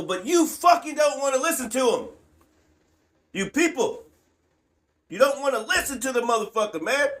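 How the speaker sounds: frustrated shout at the deaf world; exasperated shout. Real, not acted. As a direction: yell it at people who won't listen. Scolding people who won't listen.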